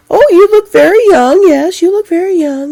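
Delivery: high, bright mock-gushing compliment; gushing stranger. Stranger complimenting the mother, mock-gushing high voice. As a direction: imitate her sweet, high flattery. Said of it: "And she's like".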